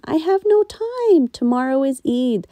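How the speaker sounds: flustered, too busy; busy daughter. A narrator's reading. Daughter refuses.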